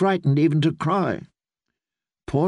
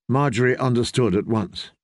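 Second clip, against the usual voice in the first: ordinary delivery, nothing marked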